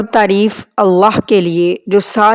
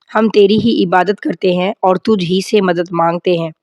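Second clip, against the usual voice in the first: ordinary talk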